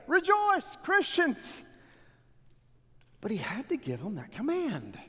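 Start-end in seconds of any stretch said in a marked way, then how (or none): 0.1-1.6 high-pitched exhortation; a sharply raised "Rejoice, Christians"; mock exhortation. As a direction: call out to the Christians like an urgent command. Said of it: "He should never have to say".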